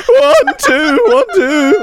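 high, loud squealing count-in; shrieking mic check. Pretend.

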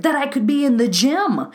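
high, emphatic self-reproach; exasperated outburst. Retold or imitated. Coach listing what those wasted hours could be, exasperated.

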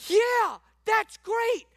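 very high, loud pulpit shouting; shouted preaching. Real, not acted. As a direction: holler the rebuttal at the congregation.

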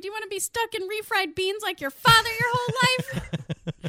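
high, loud nagging taunt; nagging monster voice. Retold or imitated. Retelling the Ambition Gremlin, high loud nagging taunt. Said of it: "she's like".

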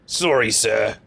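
lightly put-on British porter voice; a hint of a polite British servant; British accent. Shown as a demonstration. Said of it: "That's my, that's my British accent".

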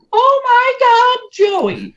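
high, slow, choked-up gasp; aunt imitated crying out on finding her nephew; tearful aunt. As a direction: let the aunt's voice break, way up high. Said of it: "And she burst into tears".

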